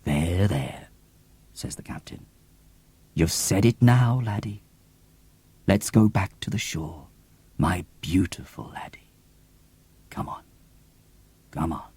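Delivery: low, soft, hushed soothing; tender comfort. Acted. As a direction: hush the boy gently, almost whispered. Captain comforts weeping Milo.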